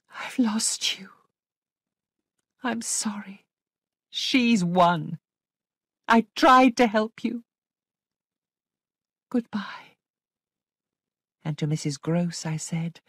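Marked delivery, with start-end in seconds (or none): 0.1-10.0 sorrowful farewell, pitched up; sad. A narrator's reading. Governess saying goodbye to lost Flora, sadly. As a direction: give up on someone you tried to help, grieving. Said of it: "and said sadly to Flora".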